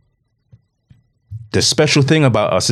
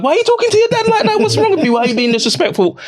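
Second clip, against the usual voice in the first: high, fast, scolding mum voice; shrill-free mock telling-off in a much higher voice; imitating his mum; faster than usual. Grandmother scolding the son for his tone, in a high mock voice. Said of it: "my mum would be like".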